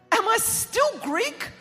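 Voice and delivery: very high, loud, drawn-out question; comic shocked outcry. Retold or imitated. Stunned daughter to her Greek mum, comically shocked outcry. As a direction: cry it out in mock disbelief.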